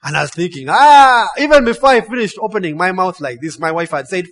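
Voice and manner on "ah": loud, high open-mouthed "ah"; mock alarmed cry. Rafter about to object to flipping, mock alarm. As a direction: start a protest with a high cry.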